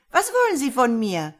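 put-on high, loud, alarmed old woman; first hysteria. A narrator's reading. Confused old woman confronting a stranger, on the edge of hysteria. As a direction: cry out with rising hysteria. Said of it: "lag erste Hysterie in ihrer Stimme".

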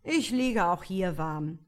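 loud, lazy fool. A narrator's reading. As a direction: shrug it off like a lazy peasant. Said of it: "der Narr sagte".

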